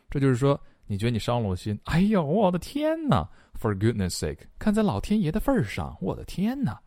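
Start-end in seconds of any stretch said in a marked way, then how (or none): 1.9-3.3 high-pitched "oh my god" wail, hammed up; exasperated exclamation. Teacher acting out the lyric's translation, exasperated exclamation. 4.6-6.8 much higher, theatrical appeal to heaven; exasperated exclamation. Acted. Teacher translating the singer's exclamation, exasperated and hammed up.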